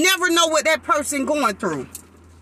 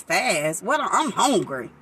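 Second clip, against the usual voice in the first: low, drawn-out grumble of a hungry person; whiny hungry complaint; 10 semitones lower, 4 dB quieter, slower than usual. Retold or imitated. A believer grumbling to God about fasting, low and whiny. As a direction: sulk at being told to fast. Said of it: "You hear it in your voice".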